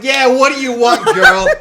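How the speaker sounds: loud, high, hammed-up Jersey bark; mock Jersey attitude. Sandwich maker to a customer near closing, loud Jersey attitude. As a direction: demand the order like a brash mock Jersey guy. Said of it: "I was probably talking Jersey".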